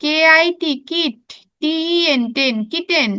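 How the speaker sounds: "কে আই টি" stretched into "কিট", pause, "টি ই এন" into "টেন"; syllable by syllable. Shown as a demonstration. Teacher drilling pupils on "kitten" in parts, syllable by syllable. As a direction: chant "kitten" in two spelled chunks, then whole. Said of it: "এরকম syllable ভাগ ভাগ করে পড়বা".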